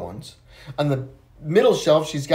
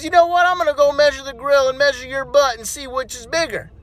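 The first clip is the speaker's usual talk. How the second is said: put-on teasing kid; 9 semitones higher than usual. Johnny teasing Susie.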